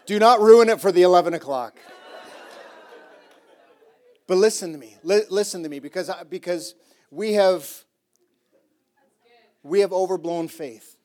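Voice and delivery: loud, high preacher's cry; loud pulpit preaching; full-force preaching. Acted. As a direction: preach it hard to the room.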